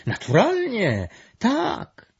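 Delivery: high, excited group exclamation; shouted. A narrator's reading. Everyone at the meeting demands the answer, shouting. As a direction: cry out eagerly, pitch way up. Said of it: "a właściwie nawet wrzasnęli".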